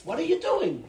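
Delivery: half-hearted imitation of an angry man; mock confrontation. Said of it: "Got in my face".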